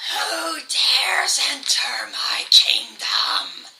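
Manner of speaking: unvoiced, slow, ghostly whisper; eerie whispering voice. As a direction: hiss it out like a hidden spirit. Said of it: "you hear a voice shriek out".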